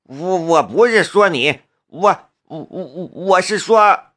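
halting, stuttered backpedalling; nervous stammer. A narrator's reading. Driver excusing himself to an angry boy, stammering. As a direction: trip over the words while backing down. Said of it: "蒋飞腾结结巴巴".

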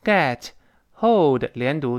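usual speaking voice

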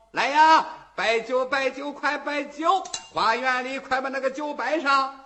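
loud commanding call for wine; calling out to servants. Acted. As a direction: bellow orders across the hall. Official calls servants for wine.

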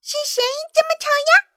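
very high, fast squeaky squirrel voice; quick high-pitched grumble; annoyed squirrel. A narrator's reading. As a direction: pipe up high as an irritated little animal. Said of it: "听到有谁在说话".

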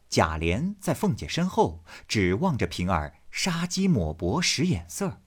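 usual speaking voice

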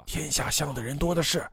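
soft, calming reassurance; soothing. A narrator's reading. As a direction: settle a frightened old friend gently. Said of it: "拍着他的背安慰道".

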